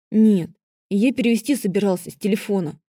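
ordinary delivery, nothing marked

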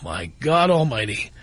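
normal talking voice

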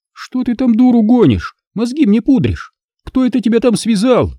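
high, gloating taunts; openly mocking Alisa. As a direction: jeer at him, delighting in it. Alisa suspicious and rude.

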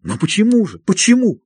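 rising to a high anguished cry; plead, breaking upward; desperate plea. A narrator's reading. Patient pleading to be freed.

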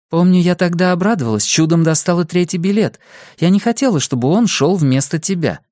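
lightly voiced mother reminiscing; mother recalling. Mother telling her son about the concert tickets, wry.

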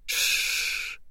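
soft unvoiced shush. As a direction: hush with a breathy shh. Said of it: "прижал к моим губам мозолистый палец".

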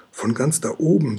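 ordinary delivery

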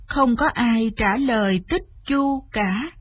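ordinary delivery, nothing marked

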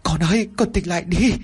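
mother holds her dying son and wails; wailing mother. Acted. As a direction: cry out for him to wake up. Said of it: "ôm anh Út mà gào khóc".

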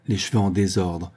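ordinary delivery, nothing marked

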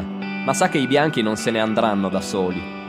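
ordinary delivery, nothing marked

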